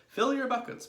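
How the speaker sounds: high, loud command; brisk order. A narrator's reading. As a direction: order the team into action. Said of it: "Mugglewump ordered".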